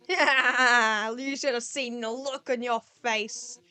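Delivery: loud laughing yell; laughing gloat. Acted. Undyne laughs at the human's face, loud. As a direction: crow over the prank.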